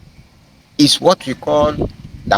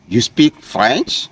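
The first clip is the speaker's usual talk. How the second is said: slow, "French" lifted as a question; rising question tone; slower than usual. Shown as a demonstration. Teacher translating the French example, rising question tone on "French". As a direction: raise the tone on "French" to ask. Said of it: "you can just raise your tone".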